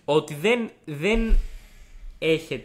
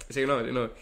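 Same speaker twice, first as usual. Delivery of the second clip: ordinary delivery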